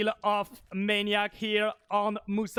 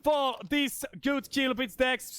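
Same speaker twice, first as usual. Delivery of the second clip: fast, hyped caster call; excited play-by-play; 4 semitones higher than usual. Real, not acted. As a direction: rush the call as the fight breaks.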